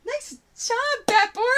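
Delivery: high squeal of praise; excited praise. Real, not acted. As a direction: cheer them on, high. Praising viewer.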